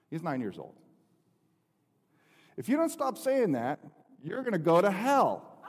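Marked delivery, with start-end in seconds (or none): 2.6-5.6 raised, higher-pitched scolding with a laugh breaking in; a fed-up kid laying down the law; fed-up warning. Retold or imitated. Nine-year-old son fed up.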